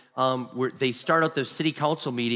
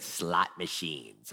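much lower, softer, slow; deadpan low answer; 8 semitones lower, 8 dB quieter, much slower than usual. Retold or imitated. Man revealing his gambling system: slow low deadpan. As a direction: say it flat and low like it's obvious.

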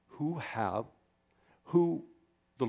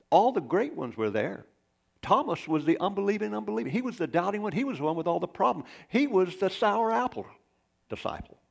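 high, loud and rising through the list; preaching at full force; 8 semitones higher, 3 dB louder than usual. Acted. Preacher to the congregation, working up about Thomas, high and loud. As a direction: drive each line up toward the climax.

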